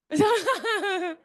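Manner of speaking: short high burst of laughter; quick real chuckle; amused laugh. Real, not acted. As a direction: laugh at her own joke. Laughs at her own name joke.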